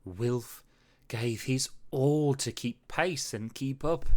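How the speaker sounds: ordinary delivery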